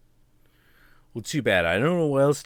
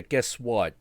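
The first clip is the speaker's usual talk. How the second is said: ordinary talk, nothing marked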